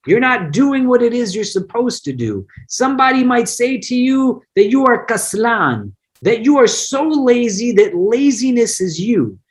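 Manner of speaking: usual speaking voice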